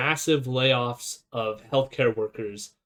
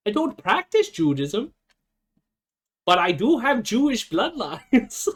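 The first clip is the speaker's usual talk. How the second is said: mimicking the wife; 6 semitones higher than usual. Mimicking the wife's clip.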